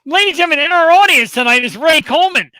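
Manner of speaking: loud, high shout-out; excited announcer. Real, not acted. Host greeting a listener in the audience, excited announcer. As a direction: belt out a big welcome.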